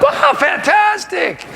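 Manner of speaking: high, slow gushing wow; mock delight. Clinton gushing at the agent's lie, mock delight. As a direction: ham up the fake delight.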